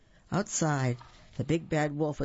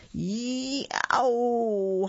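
high, drawn-out wolf yowl; howl of pain; 8 semitones higher, 4 dB louder than usual. A narrator's reading. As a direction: howl like a wolf with a burnt tail. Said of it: "howled the big bad wolf".

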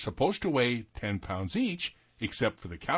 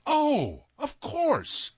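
high, loud "oh, of course"; sudden realization; 3 dB louder, much slower than usual. Real, not acted. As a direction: it suddenly clicks. Bob suddenly gets the answer.

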